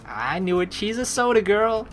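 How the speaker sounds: normal talking voice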